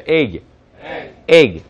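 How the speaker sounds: "egg" with a clipped, closed g; closed egg ending. Shown as a demonstration. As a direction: close off the end of "egg" firmly. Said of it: "닫아준다는 느낌으로 발음".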